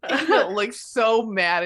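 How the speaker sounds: normal talking voice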